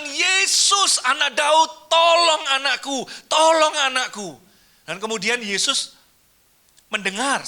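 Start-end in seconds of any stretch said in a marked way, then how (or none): 0.0-4.3 high, loud desperate plea; crying out louder. Acted. Mother crying out to Jesus for her sick child, loud and desperate. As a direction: call out to Jesus for help, louder each time. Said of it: "Dia tetap berseru makin kenceng".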